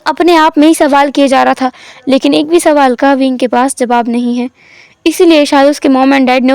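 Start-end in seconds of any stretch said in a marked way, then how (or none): none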